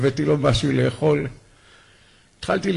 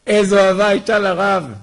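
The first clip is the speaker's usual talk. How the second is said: "איזו אהבה הייתה לרב" said again higher and louder, stress on איזו; emphatic repeat; 7 semitones higher, 6 dB louder than usual. Real, not acted. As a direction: call out the line a second time with rising fervour. (חזרה נרגשת בקול רם, דגש על "איזו")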